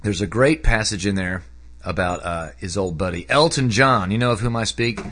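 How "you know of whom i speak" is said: ordinary talk, nothing marked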